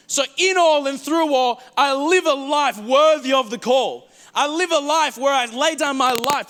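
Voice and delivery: preaching loud. Real, not acted. Preacher to congregation, swelling loud proclamation. Preacher rising to full force.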